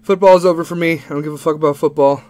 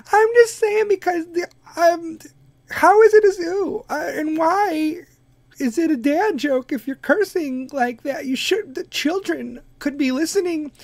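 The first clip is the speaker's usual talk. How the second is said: Kim Kardashian voice; 11 semitones higher than usual. Pretend. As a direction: play a confused Kim, pitched way up. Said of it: "the Kardashian voices".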